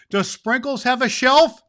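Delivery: high and loud, tickled question; amused delight. Acted. Santa asking about Sprinkles, delighted and amused. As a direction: ask it bursting with glee.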